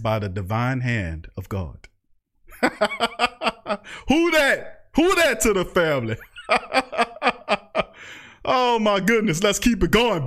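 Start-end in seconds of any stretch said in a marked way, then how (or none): 2.6-4.0 gleeful burst of laughter; real laugh. Real, not acted. Laughing on stream. 6.4-7.8 gleeful burst of laughter; real laugh. Real, not acted. Laughing on stream.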